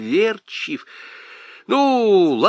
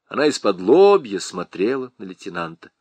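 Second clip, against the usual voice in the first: plain reading voice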